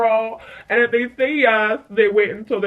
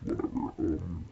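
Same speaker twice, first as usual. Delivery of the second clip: much lower, softer bored mumble; bored whatever; 9 dB quieter, faster than usual. Retold or imitated. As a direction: brush it all off, barely bothering. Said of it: "she's just like".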